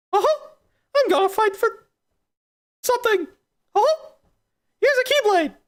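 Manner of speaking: very high falsetto cartoon mouse; Mickey. Mouse hands over a Keyblade, squeaky falsetto. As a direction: squeak it with perky chuckles. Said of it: "that's real harsh, Mickey".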